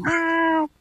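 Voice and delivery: high, soft mimicked meow; cat meow. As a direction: imitate a cat softly and high. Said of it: "she's hearing cats going".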